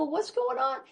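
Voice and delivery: high, concerned what's going on; surprised concern; concerned question. Retold or imitated. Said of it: "I go".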